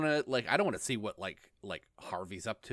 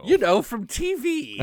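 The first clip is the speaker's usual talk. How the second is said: high, loud, drawn-out villain boast; slow, theatrical clown voice; Joker; 12 semitones higher, 8 dB louder, slower than usual. Pretend. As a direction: brag like a showboating cartoon villain. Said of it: "I'm the Joker".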